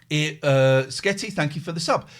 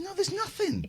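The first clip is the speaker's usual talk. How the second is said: squeaky despair; 7 dB quieter than usual. Retold or imitated. Despairing answer on phone.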